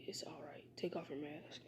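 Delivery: hushed, soft-voiced reassurance; whisper. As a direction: whisper it so only she hears. Quietly urging Karin to unmask.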